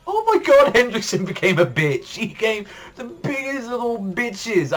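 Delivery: high-pitched, exasperated mock disbelief; exasperated outburst. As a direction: cry it out in appalled, over-the-top disbelief. Mock outburst at character turning soft.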